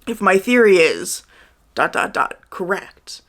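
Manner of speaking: long pause before "correct"; slow "If my theory is", dots spelled out, then "correct" set apart; pause before last word. Retold or imitated. Reviewer mocking stilted book dialogue, halting before "correct". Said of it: "an ellipsis in the middle of their sentence".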